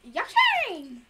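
high, loud cartoon creature cry; Yoshi. Player showing off an impression mid-game, cartoon creature squeal. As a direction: squeal the name out in a game-character voice. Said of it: "Try to do your Yoshi impression".

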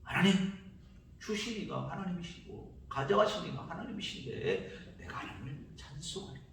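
quiet surrender in prayer; hushed prayer. Acted. Job's humble prayer. (욥의 조용한 고백 기도)

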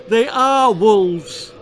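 slow, very high and loud cry; terror. A narrator's reading. Villagers cry out at the wolves, terrified. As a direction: scream it in horror.

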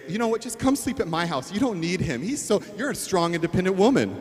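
mock girlfriend egging her to leave him; gossipy girlfriend. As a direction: hype her up like a meddling friend. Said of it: "girlfriends that are gonna say".

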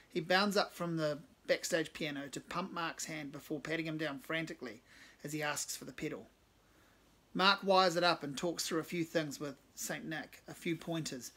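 usual speaking voice

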